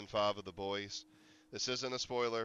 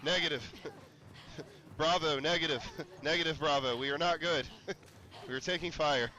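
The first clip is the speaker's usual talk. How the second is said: louder, higher hammed-up soldier on the radio; mock soldier radio call; 6 semitones higher, 4 dB louder than usual. Streamer under zombie attack, mock soldier radio callout. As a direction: play at calling in to command.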